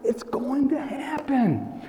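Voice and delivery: soft, slow whisper; whispered conviction. Real, not acted. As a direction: breathe it out as certainty. Whispered emphatic close.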